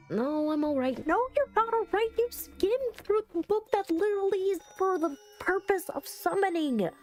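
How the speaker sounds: high-pitched, exasperated rant; exasperated scolding. Real, not acted. Player scolding the character for skimming the book, exasperated rant.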